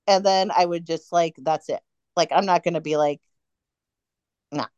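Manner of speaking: ordinary delivery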